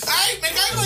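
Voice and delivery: high, loud laughing cry of falling; screaming while falling. Pretend. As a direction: shriek as you collapse, laughing. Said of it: "Cayendo lento".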